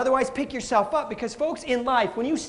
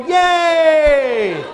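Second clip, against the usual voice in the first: cheer; 5 semitones higher, 8 dB louder than usual. Shown as a demonstration. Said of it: "and then I go".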